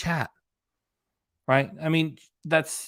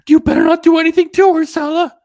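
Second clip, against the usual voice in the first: much higher, loud squeaky mock warning; mocking fan voice; 13 dB louder than usual. Vlogger mocking a gullible fan warning Sala, shrieking high. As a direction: screech it like a worked-up fan. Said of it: "it's like".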